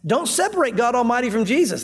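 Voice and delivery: ordinary talk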